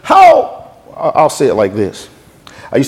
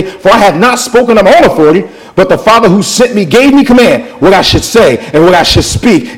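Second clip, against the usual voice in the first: forceful, raised proclamation; crying out; 5 semitones higher, 4 dB louder than usual. Acted. Preacher reads Jesus on judgment and the Father, crying out. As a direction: declare it in a high preaching cry. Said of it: "he cried out, listen now".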